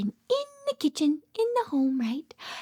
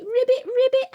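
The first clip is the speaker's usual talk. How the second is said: high, loud, playful ribbits; chirpy mock frog croak, pitched up; frog call; 7 semitones higher, 5 dB louder than usual. Answering what a frog says for little kids, playful ribbit. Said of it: "What does a frog say?".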